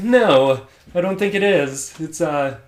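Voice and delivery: weak, failed attempt at a British accent; half-hearted mock British voice. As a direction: try a pompous British Siri, badly. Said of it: "she has a British accent".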